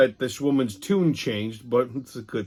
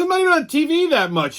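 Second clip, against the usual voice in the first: weatherman's protest; 13 semitones higher, 4 dB louder than usual. Retold or imitated. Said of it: "he goes".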